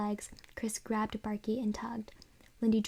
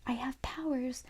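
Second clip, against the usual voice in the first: louder pitched-up warning; screamed threat; 3 semitones higher, 3 dB louder than usual. Acted. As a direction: scream threats while carried. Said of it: "the dummy screamed".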